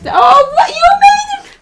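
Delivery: high, loud joyful shriek; real delighted greeting. Real, not acted. Teacher greeting a late arrival, shrieking with joy. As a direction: squeal with real surprise and delight.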